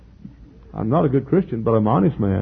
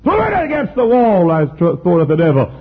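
loud, high shouted narration; full-force preacher's shout; angry outburst; 10 semitones higher, 10 dB louder than usual. A narrator's reading. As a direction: burst out yelling as you tell it. Acting Luther's fury at devil.

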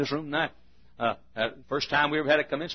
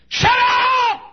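sudden high furious shout; outraged employee yelling at gossips; 12 dB louder than usual. Retold or imitated. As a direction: bark it across the store. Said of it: "you could have heard her across the street".

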